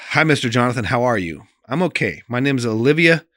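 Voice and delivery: put-on reading a sick girl's letter to the audience, earnest; reading a letter aloud. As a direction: read the child's letter with feeling.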